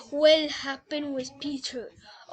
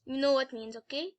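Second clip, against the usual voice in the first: ordinary delivery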